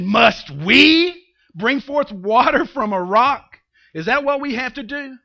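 indignant questioning, hard stress on "we"; acted bitter challenge; mad Moses. Moses speaking rashly to the people, loud indignant question. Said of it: "They made him mad".

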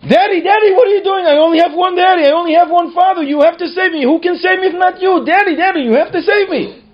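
put-on high-pitched, loud child's plea; desperate yelling. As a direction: yell like a terrified daughter begging her father. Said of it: "And she started yelling".

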